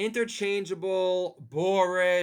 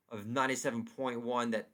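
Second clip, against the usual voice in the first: ordinary talk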